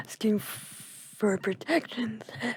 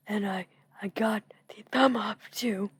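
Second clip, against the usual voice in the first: normal talking voice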